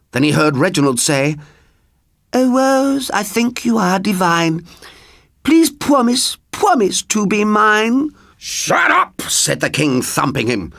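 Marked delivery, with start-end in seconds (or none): 2.3-8.1 high, swooning lover's plea; lovesick suitor. Acted. Reginald pleading with Rose, high and swooning. 8.4-9.2 high, snapped royal rebuke; irritated king. Acted. King silencing rhyming Reginald, high and exasperated.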